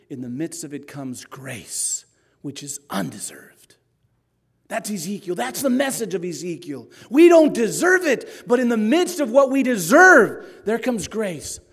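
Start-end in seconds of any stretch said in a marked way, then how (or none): 0.1-3.8 whispered. Acted. Grace contrasted with deserved anger. 7.1-11.6 preaching climax. Acted.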